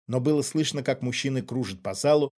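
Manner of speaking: ordinary delivery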